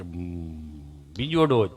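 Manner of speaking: low, hesitant mock stammer, catching before words; stammering friend. Friend answering the nurse, imitated low stammer. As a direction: hum and stick, then answer low. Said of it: "જીભ જલાઈ ચોંટે અટકે".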